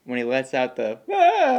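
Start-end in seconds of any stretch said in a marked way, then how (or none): 1.1-1.6 a high, loud mock bellow "Ah"; Hanks bellow. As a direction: belt out an overwrought cry. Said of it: "It's like the Hanks bellow".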